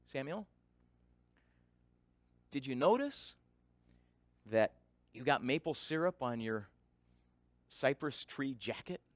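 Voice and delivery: quiet, patient father to his son; gentle calm question. Retold or imitated. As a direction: ask kindly without a trace of blame. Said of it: "here's what my first words sounded like".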